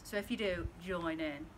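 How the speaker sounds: usual speaking voice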